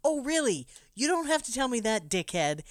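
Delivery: sarcastic angry retort; snippy retort. Retold or imitated. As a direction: snap back at the boss sarcastically. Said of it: "young Thomas says".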